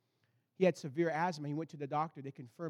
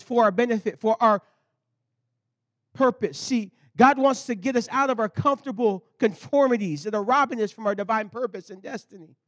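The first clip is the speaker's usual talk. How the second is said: rising, louder pulpit drive; full preaching; 2 semitones higher, 8 dB louder than usual. Real, not acted. Pastor urging the church forward, full preaching. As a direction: preach it out to the congregation.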